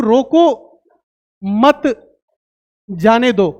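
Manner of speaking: रोको, a pause, मत, a pause, then जाने दो; long pause after रोको and after मत; pauses between words. Shown as a demonstration. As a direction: break रोको मत जाने दो into separate slow words. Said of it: "अब यहां पे फिर मैं लिखूं".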